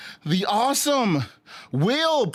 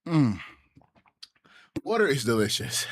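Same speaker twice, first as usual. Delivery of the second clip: ordinary talk, nothing marked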